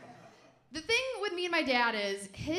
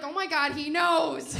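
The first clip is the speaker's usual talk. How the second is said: mock panic; 3 semitones higher, 8 dB louder than usual. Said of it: "And I was like".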